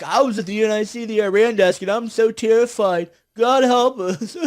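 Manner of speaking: hammed-up whimpering, dragging out the end; mocking crybaby. Commentator mimicking terrified Iran desk staffers, mock crybaby whine. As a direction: fake terrified wail dissolving into mock sobs. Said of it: "Bunch of babies".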